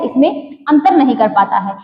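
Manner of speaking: ordinary delivery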